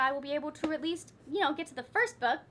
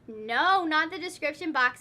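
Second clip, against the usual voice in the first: ordinary delivery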